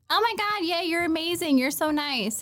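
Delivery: put-on high, gushing little girl thanks; gushing kid. Little girl thanks the Marines, high and gushing. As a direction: gush like a delighted child.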